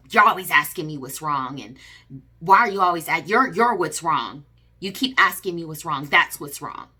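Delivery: loud angry snap at her; retold loud snap, accusing; snapping. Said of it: "he kinda just snapped at me".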